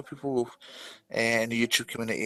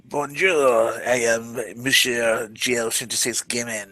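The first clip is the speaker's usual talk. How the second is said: slow, hammed-up French accent; mock French accent; slower than usual. Co-host introducing himself as Monsieur, mock French accent. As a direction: introduce yourself as a cartoon Frenchman.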